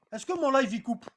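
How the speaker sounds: normal talking voice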